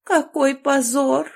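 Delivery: slow, high tragic moan; first cry of shame, slow and theatrical; dying moan. A narrator's reading. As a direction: groan it like a martyr. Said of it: "умирающим голосом простонав".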